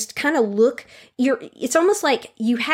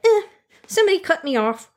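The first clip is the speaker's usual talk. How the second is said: mock shrug; 7 semitones higher, faster than usual. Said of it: "you might even be like".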